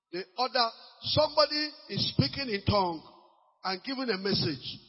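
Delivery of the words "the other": ordinary talk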